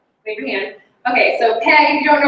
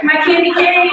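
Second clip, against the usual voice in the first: put-on sweet Southern accent; 7 semitones higher, 4 dB louder than usual. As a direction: gush it like a sweet Southern lady. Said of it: "she's got this sweet Southern accent".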